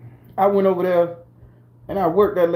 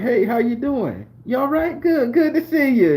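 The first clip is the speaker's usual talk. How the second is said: higher, sing-song phony greeting; mock fake friendliness; 6 semitones higher than usual. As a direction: imitate a breezy greeting that pretends nothing happened. Mocking her fake greeting at work.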